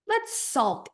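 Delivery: sulky inner part. Retold or imitated. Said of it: "a part that'll be like".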